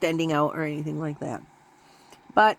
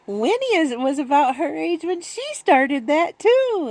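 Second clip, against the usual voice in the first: quoting Pam; 10 semitones higher, slower than usual. Retold or imitated. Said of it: "Pam says".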